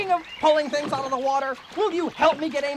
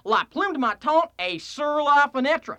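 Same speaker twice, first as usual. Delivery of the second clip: Texan French; 2 semitones higher, slower than usual. Acted. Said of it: "speak French like a Texan".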